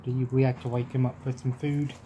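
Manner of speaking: ordinary delivery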